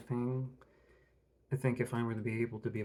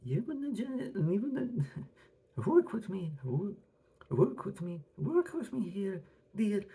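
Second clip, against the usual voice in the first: mock old lady voice; 9 semitones higher, 4 dB louder than usual. High silly character voice.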